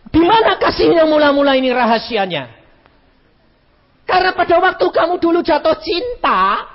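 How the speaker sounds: high, loud preacher's challenge; loud, lifted sermon voice; fiery preaching. Acted. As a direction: drive it home to the congregation. Preaching to the women.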